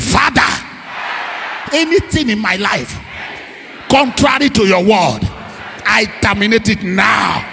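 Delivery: very loud, slow, commanding declaration; shouted prayer. Shown as a demonstration. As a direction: roar each phrase like a command. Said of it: "shout it".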